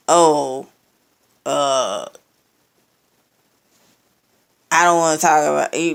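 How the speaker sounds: hammed-up evasive mumble, then rushed; mocking imitation. Mocking his evasive reply.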